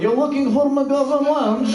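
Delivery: high, slow mock Russian accent. Campus lady checks what the driver wants, in a Russian accent. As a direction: question it back in thick Russian. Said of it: "This lady was legitimately Russian".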